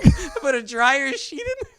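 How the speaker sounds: high, squeezed out through laughter; barely getting the words out for laughing; laughing confession. Real, not acted. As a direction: confess while cracking up. Confessing while cracking up.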